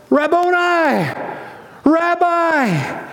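high, stretched joyful cry of recognition. Acted. Mary cries out to Jesus in joy, high and stretched. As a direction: cry out his name in overwhelming joy.

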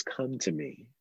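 soft, soothing answer to a protest; gentle invitation. Acted. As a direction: answer the upset one softly and calmly. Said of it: "But Jesus says".

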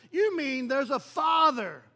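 loud, slow, amazed question; excited amazement. Acted. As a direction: imitate thrilled new believers, drawing it out. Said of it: "they're so excited about the gospel".

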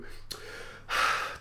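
soft mock sigh of an annoyed middle-aged woman; imitating sigh. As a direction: breathe out a passive-aggressive sigh. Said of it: "a middle-aged woman just go".

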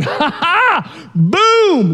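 high, smug "Ha ha, boom"; gloating disciple. Retold or imitated. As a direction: crow like a proud follower. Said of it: "they're like".

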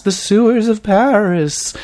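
high, slow, eerie ghostly voice. Acted. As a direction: moan it out like a haunting ghost. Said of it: "Why did I say it like a ghost?".

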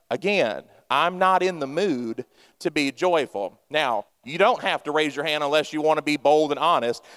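ordinary delivery

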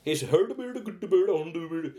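slow sing-song mock Scandinavian gibberish; Swedish Chef. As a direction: babble like a cartoon Nordic cook. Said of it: "like the Swedish chef".

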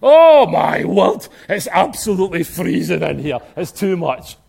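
mock shivering African bather; African brothers shivering. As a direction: complain of the freezing sea with a hint of African accent. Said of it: "my African brothers and sisters are going".

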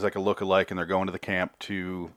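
usual speaking voice

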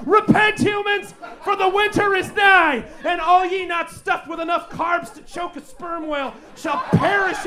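thundering doomsday prophet; hammy stage actor. Acted. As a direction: preach doom like a ham actor.

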